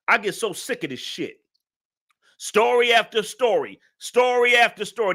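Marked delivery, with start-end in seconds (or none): 2.4-5.1 louder and higher, venting in real fury; real anger. Real, not acted. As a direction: spit it out, fed up with story after story. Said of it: "Yes, I'm mad".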